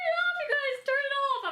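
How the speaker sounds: giggly high cry of embarrassment; laughing shriek. Real, not acted. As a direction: squeal through laughter to stop the camera. Laughing, begs to stop filming.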